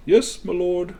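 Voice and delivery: lightly voiced servant. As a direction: answer the lord from afar. Servant answers again.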